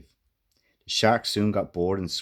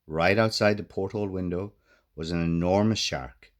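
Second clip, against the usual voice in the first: usual speaking voice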